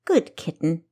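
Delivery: slow, purring king's voice; a king savoring his power, unhurried; lightly voiced toying king. King teasing his captive spy, a lightly voiced purr.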